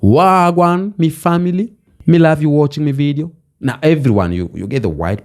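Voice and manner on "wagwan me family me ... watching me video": Jamaican patois. Pretend. Vlogger greets viewers as a Jamaican, in Jamaican patois. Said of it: "Now people are speaking patois".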